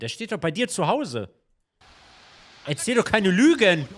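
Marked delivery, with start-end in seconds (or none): 2.7-3.9 loud, high joking outrage; mock accusation. Real, not acted. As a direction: playfully call him a liar. Teasing friend about trophy.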